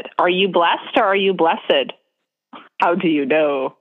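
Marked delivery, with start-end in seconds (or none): 0.2-1.9 first blessed as blest, second blessed as BLESS-ed; one syllable blessed, then two syllables, slowly; two pronunciations. Shown as a demonstration. Asking which is right, blest or bless-ed. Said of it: "needs the two syllables or not".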